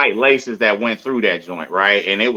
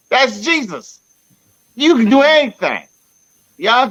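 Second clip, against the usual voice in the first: mock exclamation; 10 semitones higher than usual. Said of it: "mocking exclaim".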